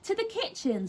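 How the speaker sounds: high, airy dodge; mother voice. A narrator's reading. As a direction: answer lightly like a fleeing mum. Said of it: "said Missus Large".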